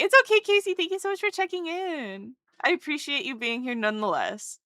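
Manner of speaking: high, soft sweet-talking voice; voicing own reply. Streamer answering viewer Casey in a high, soft, gushing voice.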